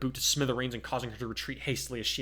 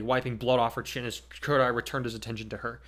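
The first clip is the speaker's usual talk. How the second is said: ordinary delivery, nothing marked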